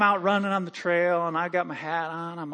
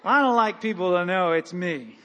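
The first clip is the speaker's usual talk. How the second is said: ordinary delivery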